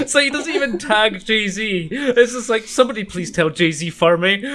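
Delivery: high, loud laughing talk. Real, not acted. Laughing while retelling.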